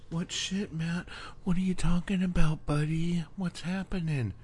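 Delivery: high-pitched imagined listener butting in; squeaky mock voice of a puzzled viewer; mock confused listener.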